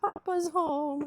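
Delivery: high, slow, loud pet voice; excited dog talk. Real, not acted. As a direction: hype the dogs up, drawn out. Greeting dogs, owner home.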